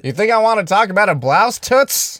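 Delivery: loud high tough-guy jeer; gangster's moll. Pretend. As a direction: sneer at her like a tough guy. Tough guy role mocking date.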